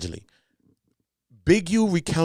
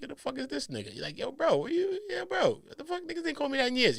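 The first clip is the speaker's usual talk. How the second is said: very high, softer squeaky grumble; mock puzzled; 4 dB quieter than usual. Reacting to someone from school calling your name, mock puzzled. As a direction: say it to yourself in high-pitched disbelief.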